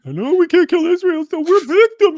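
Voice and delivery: very high, loud mocking squeal; shrill high mock whimper of self-pity; mocking whiny voice. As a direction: whine it like a cartoon victim. Mocking voice reading comment.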